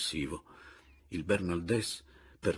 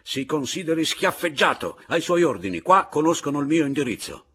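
loud, high-pitched furious challenge; shouting; 8 semitones higher, 8 dB louder than usual. A narrator's reading. Bernaldès shouts a duel challenge while retreating. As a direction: shout it out as a formal insult. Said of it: "si ritraeva gridandomi".

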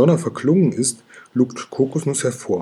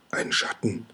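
breathy unvoiced whisper, much softer; 3 semitones lower, 8 dB quieter than usual. A narrator's reading. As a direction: whisper it as if afraid of being heard. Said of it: "flüstert das Stachelschwein".

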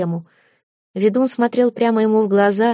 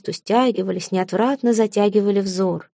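ordinary delivery, nothing marked

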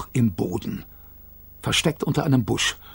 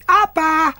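put-on much higher baby babble; 4 dB louder than usual. A narrator's reading. Kayla babbling to her father, much higher baby voice. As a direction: say it like a toddler.

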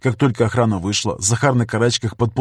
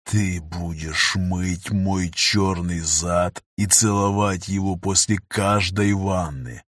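low, slow menacing hiss; hissing in the ear; 3 semitones lower, much slower than usual. Acted. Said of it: "продолжает шипеть на ухо".

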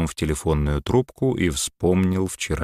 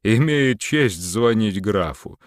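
carried away; 6 semitones higher than usual. A narrator's reading. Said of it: "понесло Антона".

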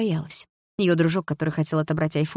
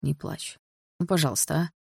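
much softer pleading; soft comfort; 5 dB quieter than usual. A narrator's reading. Лёха comforts Дилара, very soft. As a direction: coax her gently to stop crying.

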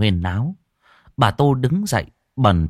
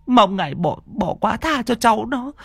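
humble begging that climbs much higher on the second phrase; pleading prayer, rising; 12 semitones higher than usual. Acted. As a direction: beg harder for the grandchild, voice lifting. Old woman praying at shrine. (khấn van xin, giọng cao dần)

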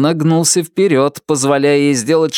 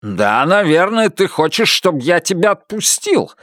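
higher, mocking and bitter; sneering accusation; 8 semitones higher than usual. A narrator's reading. Buster's paranoid accusation.

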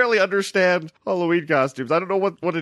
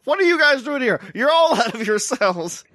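mock prison guard; 6 semitones higher than usual. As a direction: scold the guests like escaped prisoners. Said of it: "and just like".

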